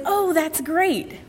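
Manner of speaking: high, slow, drawn-out approval; delighted mentor. Retold or imitated. Mentor's supportive reply retold.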